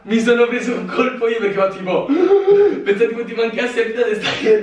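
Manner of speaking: high, laughing through the words. Real, not acted. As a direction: giggle while teasing him. Laughing after cold water prank.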